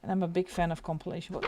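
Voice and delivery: ordinary talk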